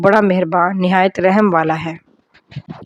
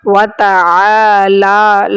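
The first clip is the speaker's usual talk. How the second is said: slow, drawn-out Arabic recitation; 3 dB louder, much slower than usual. Shown as a demonstration. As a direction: recite each word slowly and clearly. Teacher recites prayer slowly.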